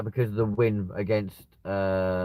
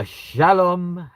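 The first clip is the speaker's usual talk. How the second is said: drawn-out greeting; 9 semitones higher, 7 dB louder than usual. Acted.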